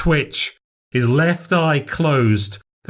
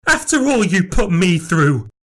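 bitter retort; 8 semitones higher than usual. Acted. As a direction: throw it back at him. Nan accusing back.

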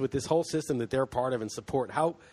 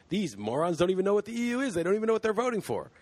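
higher, fast, condescending mockery; mocking sneer; 7 semitones higher, faster than usual. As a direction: mimic a smug TV host sneering at voters. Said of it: "John Oliver being like".

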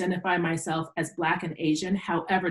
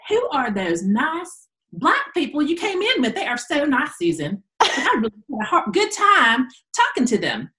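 clueless friends; 7 semitones higher, 4 dB louder than usual. Retold or imitated. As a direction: play the sweetly oblivious girl. Quoting two clueless school friends.

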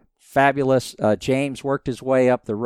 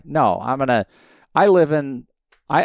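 ordinary talk

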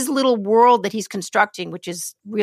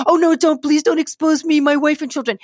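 high, pleading voice of a cornered man; mock pleading; 6 semitones higher, 3 dB louder than usual. Podcast host imitating the accused begging not to be exposed, mock pleading. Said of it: "he's like".

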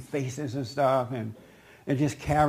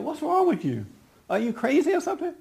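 much higher, incredulous challenge; retold confrontation, pitched way up; indignant retort; 12 semitones higher than usual. Teller confronts the woman making faces, indignant high retort.

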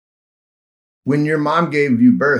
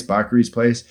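ordinary talk, nothing marked

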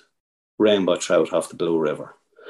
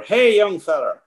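high, ringing call across the river; mock shout from afar; 11 semitones higher, slower than usual. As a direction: call out like a rancher hailing someone. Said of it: "he starts shouting".

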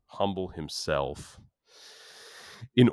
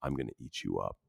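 soft, low, calm threat; quietly menacing, barely above a murmur; calm quote; 6 semitones lower, 9 dB quieter, faster than usual. Retold or imitated. Daniel calmly tells Eli he'll eat him up, soft and quiet. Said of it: "looks at Eli very calmly".

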